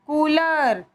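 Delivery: ordinary delivery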